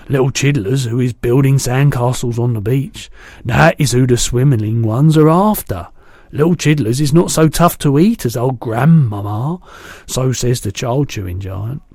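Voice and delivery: a big kindly giant chatting; booming gentle giant; the BFG. A narrator's reading. BFG voiced.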